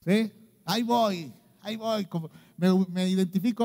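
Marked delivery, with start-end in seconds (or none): none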